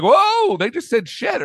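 mock shock. Mock reaction to TV swearing.

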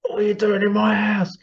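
high, indignant mock outrage; imitating an outraged friend. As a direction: play the house-proud friend losing it. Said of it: "he was going mad".

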